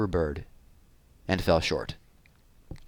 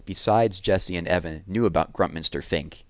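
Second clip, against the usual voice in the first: plain reading voice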